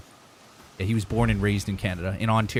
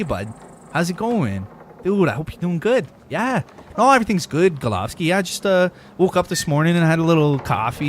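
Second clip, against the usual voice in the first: high, friendly Canadian accent, bud; exaggerated folksy Canadian accent; 6 semitones higher than usual. Shown as a demonstration. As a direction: play a cheery Canadian saying hi. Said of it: "Now, if I was talking like this".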